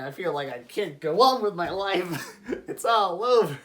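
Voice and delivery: half-laughing fake cry; mock despair. Heartbroken ex describing breakup.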